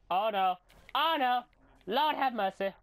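loud, rising cries of alarm; high-pitched mock dread; mock panic. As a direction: build into hammed-up panic. Mock panic building in game.

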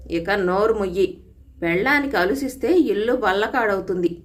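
ordinary delivery, nothing marked

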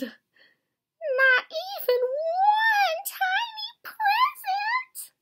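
very high, slow squeaky wail; tiny mouse lament, drawn out high; crying out as Mouse. A narrator's reading. Small guilty-eyed Mouse to Bear about presents, crying out. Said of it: "Mouse cried".

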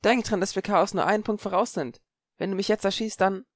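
put-on high girl's voice, pressing; worried girl arguing; urgent girl Parvati. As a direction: plead her case fast.

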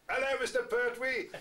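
mock cabbie hail, high and loud, laughing; hammed-up cabbie greeting; cheery taxi driver. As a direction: call it out like a cheeky cabbie. Taxi drivers calling out teasingly.

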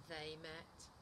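animated picture-book narration, pitch swinging up; lively storytime voice, key words punched; story reading to children. A narrator's reading. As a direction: read it to little children with big expressive emphasis. Reads second story aloud.

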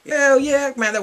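loud delighted holler; excited shout. Real, not acted. Fan hollering about the rapper. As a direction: holler your approval.

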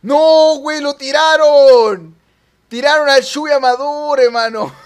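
very loud, high, stretched-out cry; dismayed shouting. Real, not acted. Player seeing Chuy Amador knocked off, long dismayed yell. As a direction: howl at the unfairness.

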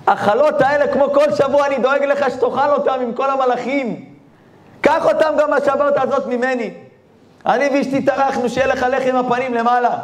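high, innocent plea to God; naive prayer voice. Acted. Simple man prays over challot.

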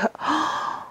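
soft, high intake of breath; awed gasp. Real, not acted. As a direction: gasp softly at something beautiful. Sees the wrapped cloth.

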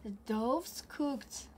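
ordinary delivery

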